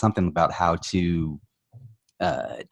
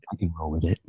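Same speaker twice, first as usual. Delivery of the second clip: softer, sultry murmur; sexy voice; 3 semitones lower, 6 dB quieter, faster than usual. Pretend. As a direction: keep the seductive act going. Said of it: "You got my sexy voice going".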